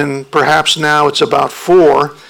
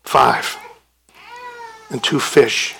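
heads hanging; 6 dB quieter than usual. Pretend. Humiliated disciples admit their tiny supply to Jesus, dejected. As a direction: mumble it down, defeated. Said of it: "with their heads hanging".

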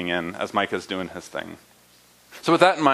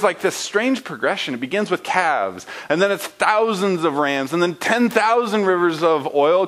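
normal talking voice

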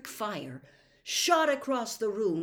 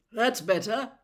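out of breath; 3 semitones higher, 7 dB louder than usual. A narrator's reading. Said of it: "panted Mister Weasley".

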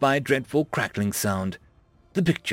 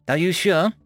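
higher, louder frightened question; terrified; 5 semitones higher, 4 dB louder than usual. A narrator's reading. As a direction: ask it full of terror. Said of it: "voice fraught with terror".

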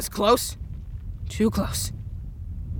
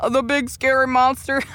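words broken up by high giggles; laughing mockingly; 4 semitones higher than usual. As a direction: cackle at the villain between words. Hero mocks villain's broken voice.

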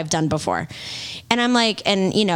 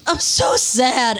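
mock sad whine; 3 semitones higher, much slower than usual. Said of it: "I want him to go".

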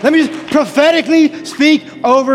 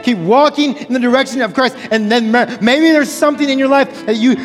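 usual speaking voice

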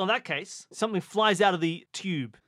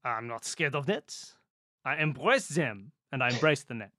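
mock French accent; 4 semitones lower than usual. Player reassuring a teammate about nets, put-on French accent. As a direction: lower French-accented declaration. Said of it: "speak like a, a Frenchman".

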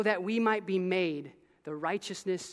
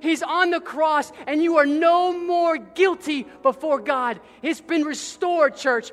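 higher, louder fervent preaching; preaching at full force; 5 semitones higher, 8 dB louder than usual. Acted. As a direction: drive the truth home to the church. Preaching climax.

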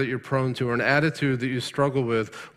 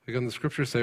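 ordinary delivery

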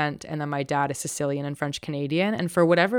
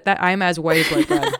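laughing; 2 semitones higher, 5 dB louder than usual. Real, not acted. Laughing at herself.

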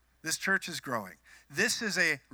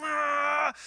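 long, high, loud "waah"; excited wail; 11 semitones higher, 5 dB louder than usual. Retold or imitated. Mimicking the thrilled ride.

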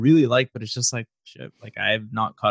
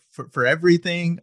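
normal talking voice

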